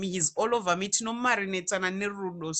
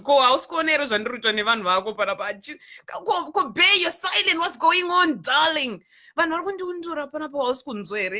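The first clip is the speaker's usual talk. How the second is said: higher, louder, fast complaint; pleading outcry; 6 semitones higher, 6 dB louder than usual. Retold or imitated. Complaining to Jesus about people insulting her, loud plea. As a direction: cry out to God in frustration.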